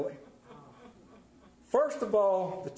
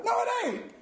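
sharp rebuttal; faster than usual. Real, not acted. Preacher rebuts a common claim.

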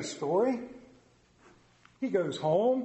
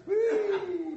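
high delighted Whee; happy whoop of fun; gleeful whee; 13 semitones higher than usual. Real, not acted. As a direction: squeal with joy at the crowd. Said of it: "That was fun".

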